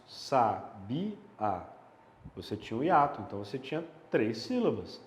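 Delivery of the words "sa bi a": "sa, bi, a" as three separate beats; "sa, bi, a" with a pause after each syllable. Shown as a demonstration. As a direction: split it up slowly: "sa", "bi", "a".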